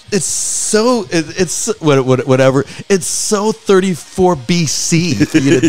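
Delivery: put-on high whiny teen voice; whiny woke son. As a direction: whine it like a preachy teenager. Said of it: "And he's like".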